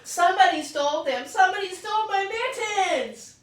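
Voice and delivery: put-on frantic child calling nine one one; hollering. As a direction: holler for help like a siren. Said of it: "I hollered".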